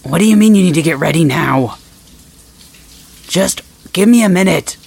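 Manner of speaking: loud, irritated call through the door; annoyed shout. Acted. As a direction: snap back loudly at them. Arguing through the bathroom door.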